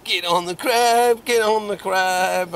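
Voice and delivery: hammed-up shouting at the fish; imitating a mate yelling at his rod; mock frantic urging. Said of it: "he's going".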